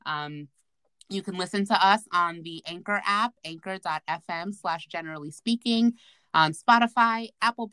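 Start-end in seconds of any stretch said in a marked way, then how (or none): none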